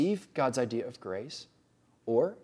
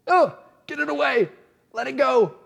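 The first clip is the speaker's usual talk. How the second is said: much higher and louder, a frantic brush-off; mock panicked plea; 11 semitones higher, 7 dB louder than usual. Voicing Paul's first reaction, frantic and high. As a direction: shoo the pain away like a startled man. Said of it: "That's Paul's".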